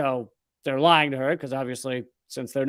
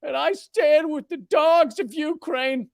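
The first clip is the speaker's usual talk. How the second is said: much higher, loud sarcastic sing-song voice; mocking liberal; 11 semitones higher, 4 dB louder than usual. Mocking every liberal in the United States: high, shrill-free sarcastic voice. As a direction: squeal it like a self-righteous activist. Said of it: "every liberal across the United States is like".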